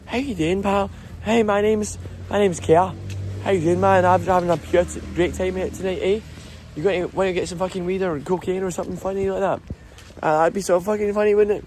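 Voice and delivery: put-on high-pitched raspy Glasgow Ned accent. Ned chatting up a pal on a night out, Ned accent. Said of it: "That's, like, the Ned accent".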